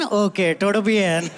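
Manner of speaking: a hint of Indian accent on casual Spanish. Kid replying to a schoolmate, Spanish in Indian accent. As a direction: answer breezily in Spanish, Indian lilt. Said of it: "speaking Spanish in an Indian accent".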